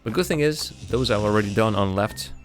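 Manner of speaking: ordinary talk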